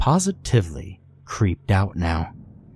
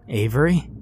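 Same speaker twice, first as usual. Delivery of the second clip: confused call; 5 semitones higher than usual. A narrator's reading. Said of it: "Cam called, confused".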